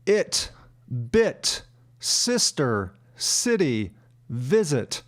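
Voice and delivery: "It, bit, sister, city, visit" each with a short ih; short I sound. Shown as a demonstration. Teacher listing examples, short ih in each word. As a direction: separate words, lax ih in "bit". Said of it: "pronounced as I".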